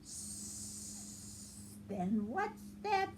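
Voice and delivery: hissed s, then slow snake voice; Snake hissing. Acted. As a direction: start with a hiss, drag the words like a snake. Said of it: "said Snake".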